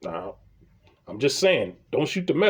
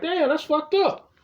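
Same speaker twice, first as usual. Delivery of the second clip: much higher, slow and drawn out; stunned indignation; 11 semitones higher, slower than usual. Retold or imitated. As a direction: let the unfairness sink in slowly.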